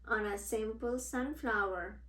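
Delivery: plain reading voice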